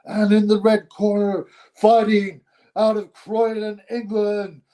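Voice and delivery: high, slow boxing ring introduction; demonstrating a big, projected announcer call. Shown as a demonstration. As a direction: stretch the fighter's name out like a fight-night MC. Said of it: "it's not a hard job to do".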